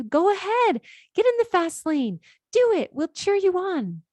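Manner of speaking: high, bright rallying call urging her forward; excited supporter shouting go ahead; cheering encouragement. Pretend. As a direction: cheer her on like a crowd at a race.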